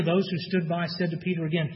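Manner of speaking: ordinary talk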